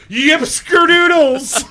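high, loud, slow hillbilly holler; belted yell. Retold or imitated. Friend mimics the holler, loud high cowboy yell. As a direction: belt it out like a cowboy. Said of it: "Zach just belts out a".

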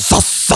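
"Sus" loud and hissed, voiceless; exaggerated S. Shown as a demonstration. Host copying the coached ending, loud hissed "Sus". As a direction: explode "Sus" in a hard loud hiss. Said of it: "emphasize that".

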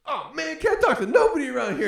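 high, loud hammed-up complaint; mock mad outburst. Rebuffed guy complaining to Suge, high mock-mad outburst. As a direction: imitate a man storming off in a huff. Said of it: "he storms off all mad".

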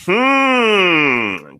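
a high, drawn-out pondering hmm; thoughtful hum. Real, not acted. Host weighs a chat viewer's idea, thoughtful hum. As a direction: mull the idea over out loud, considering it.

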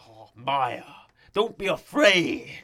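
slow, loud, looming Kingpin voice; Kingpin impression. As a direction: loom over her with a calm menacing growl. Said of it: "he's like".

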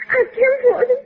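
crying plea. Acted. Girl gives up the papers in fear.

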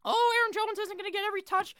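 high mocking falsetto; Matt LaFleur. Host mimics coach's running back committee line, mocking falsetto. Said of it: "what Mister Matt LaFleur says".

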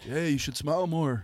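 drawn-out mocking catcall; cringe pickup guy. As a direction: imitate a guy with a bad pickup line. Mocking guys' cringe pickup line.

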